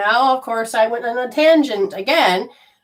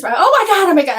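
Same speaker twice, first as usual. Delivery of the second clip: much higher, louder retold outburst; mock panic; 10 semitones higher, 5 dB louder than usual. Frantic caller reaching the police, mock panicked. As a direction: burst out as if desperate for help.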